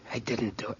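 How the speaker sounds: hushed, breathless denial in a whisper. Acted. Bill whispers his denial to Ora. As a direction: whisper it as if no one will believe you. Accused man denies shooting himself.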